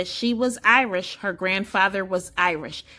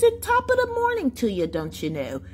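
high, quick mock Irish accent, dropping at the end; 6 semitones higher than usual. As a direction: put on a playful Irish accent. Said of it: "and an Irish accent".

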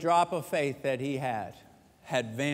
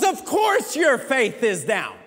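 father's verdict; 6 semitones higher, 6 dB louder than usual. Retold or imitated. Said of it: "He goes".

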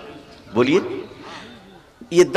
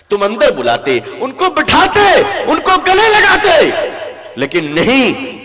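loud, high sermon voice; impassioned preacher; fiery preaching; 10 semitones higher, 7 dB louder than usual. Real, not acted. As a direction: rebuke the congregation at full force. Preaching at full force.

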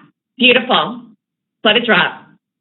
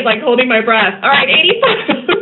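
ordinary talk

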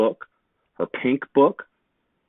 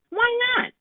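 put-on very high, sleepy little girl voice; little sister. A narrator's reading. Woken little sister asks Jake why, in a tiny high voice.

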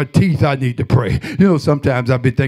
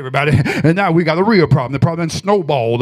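ordinary talk

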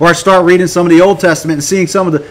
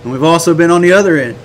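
ordinary talk, nothing marked

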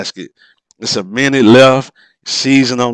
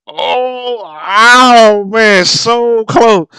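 anguished groan; 10 semitones higher, 4 dB louder, much slower than usual. Real, not acted. Agonised at a near miss.

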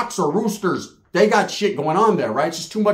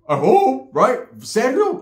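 anxious cousin; 6 semitones higher, much slower than usual. Retold or imitated.

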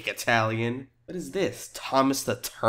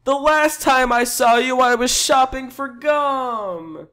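overdramatic; 11 semitones higher, 5 dB louder than usual. Acted. Customer recalls last meeting, high overdramatic wail. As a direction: wail it like a soap-opera reunion. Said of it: "Make it more dramatic".

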